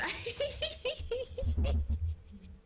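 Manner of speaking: long, high-pitched giggling fit; helpless giggle. Real, not acted. Laughing at own misreading of a word, helpless high giggle.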